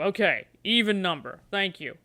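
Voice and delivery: ordinary talk, nothing marked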